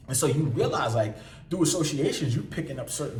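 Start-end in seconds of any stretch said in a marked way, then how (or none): none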